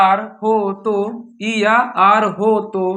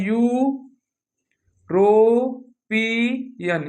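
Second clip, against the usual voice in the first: drawn out slowly; much slower than usual. Shown as a demonstration. Teacher sounding out European very slowly for the class. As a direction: stretch European out syllable by syllable, very slow. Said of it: "क्या लिखा है?".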